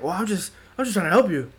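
high, earnest dad voice; imitating dad. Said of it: "He's just thinking, like".

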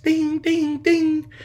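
high, ringing ding noises; imitated text alerts. Storyteller mimics dad's flood of texts, high dings. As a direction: mimic a phone pinging, high-pitched.

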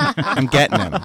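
giggling playful claim; laughing. Pretend. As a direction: laugh through it, mock scheming. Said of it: "you said".

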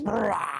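rolled, drawn-out mock roar; imitated beast roar; monster roar. Imitates the monster breaking loose.

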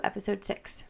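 normal talking voice

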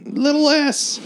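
high nagging voice; mock picky old lady. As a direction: nag like a fussy customer. Picky customer retold.

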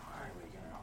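a quick hushed whisper; whispered aside. Acted. Muttering to partner about coins, a soft whisper. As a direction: drop to a whisper under your breath.

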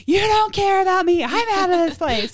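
high, loud, hammed-up indignation; mock teen outburst. Teen storming off after mom missed the story, mock outrage. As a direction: wail it like a wronged, dramatic teenager. Acting out herself as frustrated teen.

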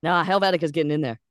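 fast, loud, right on the mic; close to the mic. Pretend. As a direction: lean into the mic and push it. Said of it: "how much you leaned into the mic".

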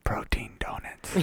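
soft unvoiced whisper. Real, not acted. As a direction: slip it in under the breath. Whispered joke aside.

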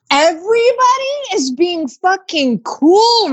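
high, slow, laughing half-shout; laugh-cry it out in disbelief; laughing outburst. Real, not acted. Venting to a friend about fees, in a high laughing half-shout.

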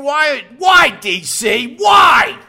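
high, loud, incredulous yelling; exasperated shout. Real, not acted. Host yelling at cohost DC, exasperated shout. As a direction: hurl the whys at him in disbelief.

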